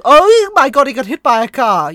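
high, loud mock-horrified cry; mock horror. Acting an onlooker at Brian's accident, mock-horrified. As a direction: cry out in exaggerated shock.

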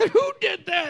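imitating his own shock; mock surprise. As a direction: gasp it in disbelief. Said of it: "And I was like".

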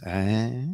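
drawn-out "eh" gliding upward; mock demo of an upward lilt; imitated rising intonation. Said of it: "that's my professional voice analysis".

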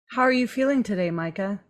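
mock therapist. Host playing therapist to Micah, lightly soft-spoken.

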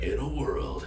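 stuffed-up movie trailer voiceover; movie trailer guy. Acted. Host joking that a stuffed-up nose suits trailers, movie trailer voice. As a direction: put on a trailer announcer's voice. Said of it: "be like the movie trailer guy".

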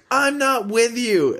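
high slow mock voice of the woman; imitating the woman. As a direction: drag it out in a squeaky impression. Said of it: "And she goes".

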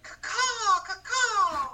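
high cawing crow call. Pretend. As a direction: caw like a crow, laughing. Imitating crow calls.

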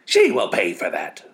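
slow, loud roar. Acted. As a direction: roar a threat of revenge. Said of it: "they roared".

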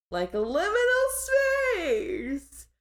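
high, slow eerie tone; drawn-out spooky voice. Acted. Spooky liminal space aside.

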